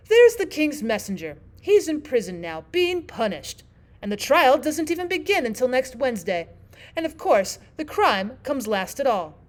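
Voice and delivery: loud, emphatic storybook Queen; imperious Queen. Acted. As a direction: explain it as the Queen.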